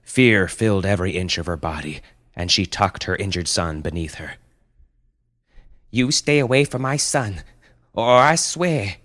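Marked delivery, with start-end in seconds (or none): 5.9-9.0 raised, high mother's warning; protective threat. Acted. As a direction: warn it off your child, voice up. Mother threatens looming panda.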